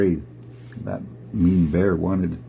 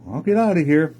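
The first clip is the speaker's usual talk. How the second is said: bear giving in; 6 semitones higher than usual. Acted. Big bear backs off.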